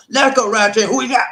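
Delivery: loud, high host shout; hype call-out. Real, not acted. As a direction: holler it like a train roll call. Host calls out the next commenter.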